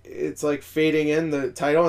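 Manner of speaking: normal talking voice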